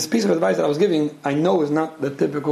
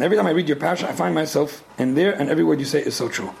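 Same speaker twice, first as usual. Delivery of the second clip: ordinary talk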